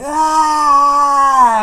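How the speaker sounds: high, loud mock yowl; long shriek like an angry cat; imitated toddler meltdown wail; angry cat screech. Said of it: "it's like fucking angry cats".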